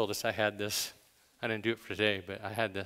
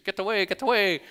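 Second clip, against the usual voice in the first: put-on higher, louder grumpy old miser shooing people off; Scrooge; 9 semitones higher, 6 dB louder than usual. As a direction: snap it out like a crabby old miser. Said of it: "I think of Scrooge".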